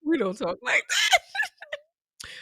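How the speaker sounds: high squealing protest through laughter; laughing protest. Real, not acted. Sister laughs off the auntie impression, squealing through laughter. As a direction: squeal it out while cracking up.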